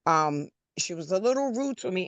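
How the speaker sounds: ordinary talk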